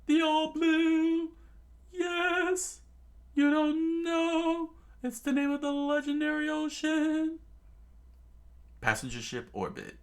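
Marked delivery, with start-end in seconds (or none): 0.0-7.4 high, loud, slow eager cry; young boy's excited high voice. Acted. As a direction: gush about a dream ocean. Young Sanji excited dreaming.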